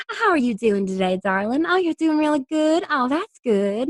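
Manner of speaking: put-on sugary Southern accent on the phone; sweet Southern charmer. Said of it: "this sweet Southern charmer accent".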